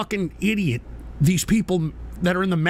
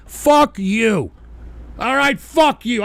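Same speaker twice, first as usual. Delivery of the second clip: loud, raised, emphatic rebuff; angry dismissal; 4 semitones higher, 4 dB louder, much slower than usual. Real, not acted. Streamer refusing banned chatters' pleas, loud and angry. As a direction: tell them off loudly.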